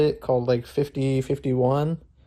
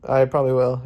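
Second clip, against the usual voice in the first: ordinary talk, nothing marked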